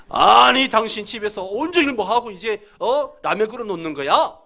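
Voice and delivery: loud, high, angry husband scolding his wife. Acted. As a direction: burst out in indignant complaint. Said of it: "그러면서 막 화를 냅니다". (버럭 화내는 남편 말투)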